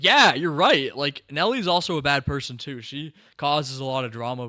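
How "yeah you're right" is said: an emphatic mock-shouted yeah; retold loud agreement. Reviewer loudly agreeing with Nellie's scolding. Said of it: "And I was like".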